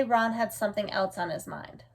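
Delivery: plain reading voice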